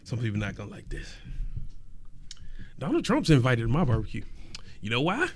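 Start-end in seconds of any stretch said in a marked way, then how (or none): 0.0-1.1 words around a sip of drink; while sipping a drink. Real, not acted. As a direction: talk right after taking a sip. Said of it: "Let me take a sip".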